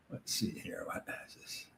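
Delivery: whispering to himself while scanning the questions; whispered aside. Real, not acted. Host reading viewer questions, whispering to himself under his breath.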